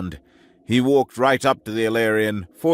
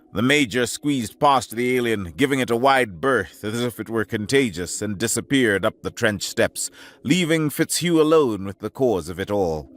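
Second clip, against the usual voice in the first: plain reading voice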